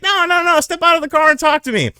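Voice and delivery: very high, insistent mocking cop; officer insisting he step out. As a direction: squeak out the order like a cartoon cop. Cop voiced in story.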